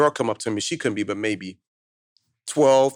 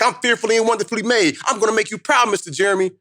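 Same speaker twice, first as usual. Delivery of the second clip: put-on higher, girlish voice full of hope; young girl; 8 semitones higher than usual. Speaker imitating the schoolgirl thanking him, higher and hopeful. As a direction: play a grateful twelve-year-old.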